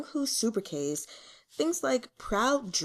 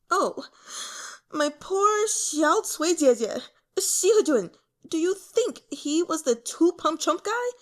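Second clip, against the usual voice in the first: hammed-up lament; 8 semitones higher, 4 dB louder than usual. Youth mourns barmaid, then asks.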